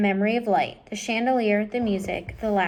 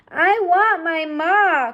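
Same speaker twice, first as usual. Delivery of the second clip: slow, high, tearful wail; crying; 8 semitones higher, 7 dB louder, slower than usual. Acted. Mig crying for her mother, slow tearful wail. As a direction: sob out the longing. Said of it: "cried Mig".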